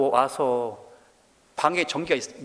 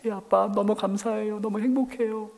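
higher voice of a son answering dad; imitated grateful child; 7 semitones higher than usual. Voices his son's grateful reply. (높은 목소리로 흉내 낸 감사하는 아들)

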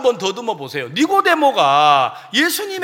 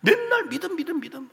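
weary complaint; 7 semitones higher, 7 dB quieter than usual. Retold or imitated. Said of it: "또 저 소리".

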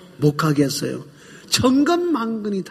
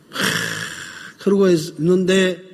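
ordinary talk

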